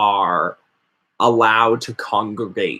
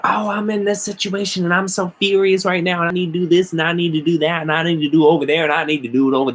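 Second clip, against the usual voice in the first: much higher, very fast panicked babble; mock frantic rant; 6 semitones higher, much faster than usual. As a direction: gabble it all in one panicked breath. Mocking frantic spell-seekers.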